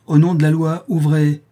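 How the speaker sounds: high, loud order through a door; commanding shout. Acted. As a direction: demand entry in the law's name. Police repeat order at door.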